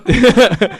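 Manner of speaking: sudden high loud laugh; bursting laugh. Real, not acted. Teased by friends about tearing up, loud laugh.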